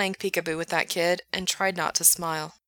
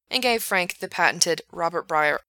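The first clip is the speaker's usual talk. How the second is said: plain reading voice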